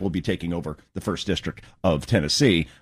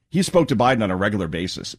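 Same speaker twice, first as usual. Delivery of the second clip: normal talking voice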